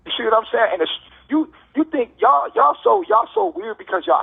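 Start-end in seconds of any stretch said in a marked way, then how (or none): 1.3-4.2 heated. Real, not acted. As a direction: voice rising high in frustration. Heated rant at critics.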